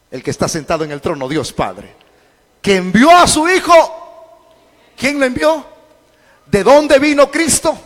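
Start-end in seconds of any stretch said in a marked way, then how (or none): 2.6-7.8 high, loud, insistent; full-force preaching. Real, not acted. Preacher questions the congregation on who sent Christ, full-force preaching.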